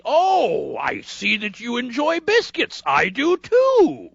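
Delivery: high, slow gushing politeness; overly sweet clerk. Retold or imitated. Clerk fawning over a customer's biscuits, high and slow. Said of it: "this guy's being like".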